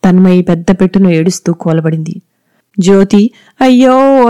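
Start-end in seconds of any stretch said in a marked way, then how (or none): 3.6-4.3 shouted ayyo, pitched much higher; a sharp, loud wail of dismay; loud cry of alarm. Acted. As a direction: cry out in sudden shock. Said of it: "గట్టిగా అరుస్తూ".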